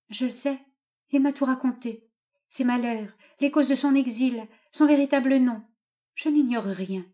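mildly acted defiant daughter; resolute daughter. A narrator's reading. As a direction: stand up to father, voice lifted.

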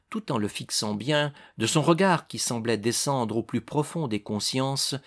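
plain reading voice

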